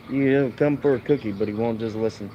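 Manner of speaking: usual speaking voice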